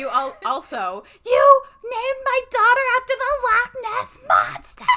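high, loud breathy scream; whisper yelling. Retold or imitated. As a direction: shriek it but keep it whispered. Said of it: "so I whisper screamed".

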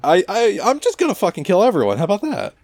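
high-pitched villain threat; mock outburst. Villain announcing he'll kill everyone, mock outburst. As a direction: push it up high and fast.

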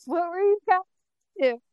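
high-pitched imitated question; mock questioning. Retelling the scene with Jax, Sarah and Martin, high questioning voice. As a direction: pitch it up as a retold group question.

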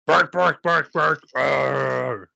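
spoken dog barks, then a growly roar; Rolf. Acted. Co-host plays a Muppet remembering to bark, gruff barking. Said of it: "he'll respond like Rolf and go".

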